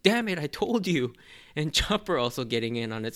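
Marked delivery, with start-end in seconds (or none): 0.0-1.1 higher, louder cartoon scolding; imitating Sanji. Sanji scolding Luffy about the cold, loud mock anger. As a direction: snap it back like an annoyed friend. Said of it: "and Sanji's like".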